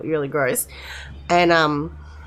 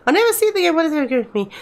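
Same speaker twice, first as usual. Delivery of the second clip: mock wail; 9 semitones higher, much faster than usual. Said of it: "she cried".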